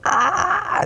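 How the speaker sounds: shrill piercing ah; high panicked scream. Retold or imitated. Animal freaking out before slaughter, high scream. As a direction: let out a high panicked cry. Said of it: "instead of freaking out like that".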